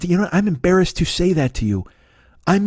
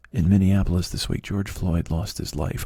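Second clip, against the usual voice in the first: lowered, softer mock news intro; mock somber newsreader; 8 semitones lower, 4 dB quieter than usual. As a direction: drop into a grave solemn broadcast. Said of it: "started to talk like this".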